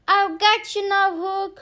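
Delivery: loud, high heroic shout; shouting challenge. Acted. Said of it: "He flew onto the ship and shouted".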